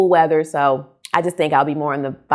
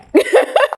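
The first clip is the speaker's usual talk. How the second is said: sheepish laugh; 4 dB louder than usual. Real, not acted. Laughs off long-listed book.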